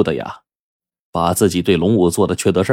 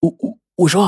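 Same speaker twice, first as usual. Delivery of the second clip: nervous stammer; 9 semitones higher than usual. Acted. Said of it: "心里就不禁一阵慌".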